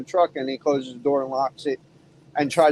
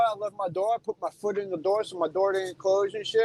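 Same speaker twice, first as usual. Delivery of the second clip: ordinary talk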